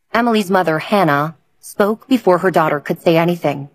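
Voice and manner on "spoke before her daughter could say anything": usual speaking voice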